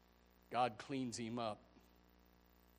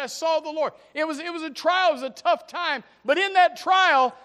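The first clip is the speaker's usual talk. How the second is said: very loud, much higher preaching shout; preaching at full force; 19 dB louder than usual. Real, not acted. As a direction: preach it at the top of your voice. Peak of preaching.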